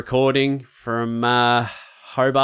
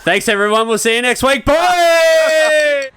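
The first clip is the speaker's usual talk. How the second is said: excited sign-off; 13 semitones higher, 6 dB louder, slower than usual. Real, not acted. Cheerful show sign-off.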